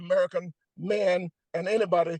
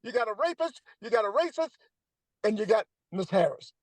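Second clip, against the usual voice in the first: higher, incredulous challenge to undecided voters; exasperated scolding; 6 semitones higher than usual. Real, not acted. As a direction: scold them in disbelief.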